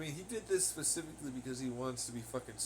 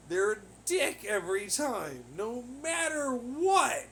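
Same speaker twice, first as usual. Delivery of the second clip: louder, much higher and slow; angry rant; 9 semitones higher, 7 dB louder, slower than usual. Real, not acted. Ranting about a man who wrecked his life, outraged. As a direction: drag it out in rising outrage.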